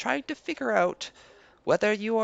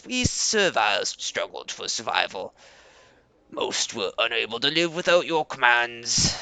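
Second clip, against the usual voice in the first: Leader Koba, proud; 11 semitones lower than usual. Acted. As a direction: greet guests with stiff pride. Koba speaks as NPC.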